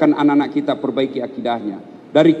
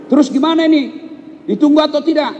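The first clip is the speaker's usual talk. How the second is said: higher, louder pressing question; urgent question; 10 semitones higher, 3 dB louder than usual. Retold or imitated. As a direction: press the son anxiously for an answer. Ustaz pressing son on phone. (bertanya mendesak)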